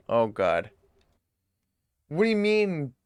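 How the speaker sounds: ordinary delivery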